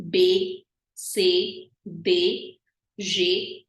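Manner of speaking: French letters "B, C, D, G" as low, short bay, say, day, zhay; each of "B, C, D, G" ends in a light ay; slight A sounds. Shown as a demonstration. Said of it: "all of these are slight A sounds".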